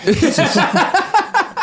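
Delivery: high real laughter; laughing. Real, not acted. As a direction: burst out laughing. Laughing at himself.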